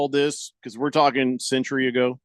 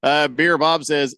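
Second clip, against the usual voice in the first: ordinary talk, nothing marked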